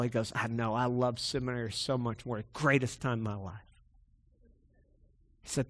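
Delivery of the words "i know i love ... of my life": hushed, low conviction; quiet sincerity. Retold or imitated. Mentor telling a student he loved seminary, quietly sincere. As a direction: insist softly, dropping the pitch.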